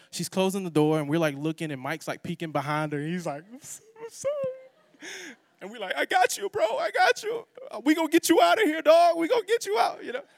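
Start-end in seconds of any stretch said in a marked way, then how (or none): 3.5-5.4 high, slow, pitiful apology in a put-on voice; sheepish friend voice. Imitating Mike's apology from door. 6.0-10.0 put-on hyped kid voice. Kids calling to trapped friend.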